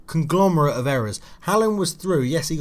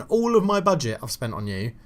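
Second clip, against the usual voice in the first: ordinary talk, nothing marked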